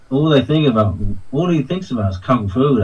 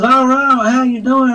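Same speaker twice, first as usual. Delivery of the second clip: higher Texas twang greeting; Texas accent; 10 semitones higher than usual. Retold or imitated. Retelling how the actor greeted him, in a put-on Texas accent. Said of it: "he speaks with a Texas accent".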